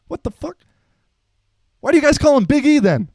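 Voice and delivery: very high, loud and fast exasperated coach; outraged coach. Retold or imitated. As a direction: blow up in disbelief at the players. Coach outraged over nickname.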